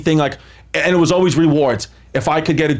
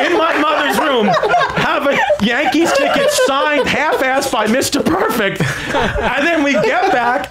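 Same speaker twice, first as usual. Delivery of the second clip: higher, slow, laughing through the words; can't stop laughing at the memory; laughing while telling; 8 semitones higher, slower than usual. Real, not acted.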